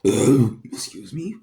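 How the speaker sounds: burping while talking; burped. Real, not acted. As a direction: excuse me through a burp. Burping mid-sentence, excusing self.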